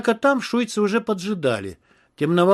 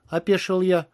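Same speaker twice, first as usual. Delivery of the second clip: usual speaking voice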